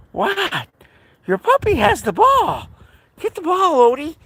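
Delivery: squeaky high voice like cooing at a puppy. Real, not acted. High baby talk to dog.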